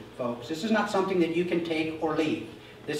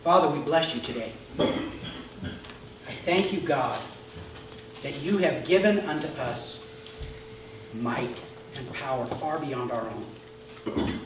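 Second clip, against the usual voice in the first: soft, lower, reverent prayer voice; closing prayer; 3 semitones lower, 7 dB quieter than usual. Acted. Pastor leading the congregation in a closing prayer, hushed reverent. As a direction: pray quietly over the church.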